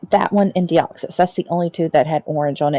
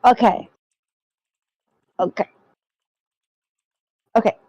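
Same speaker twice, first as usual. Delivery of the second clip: ordinary talk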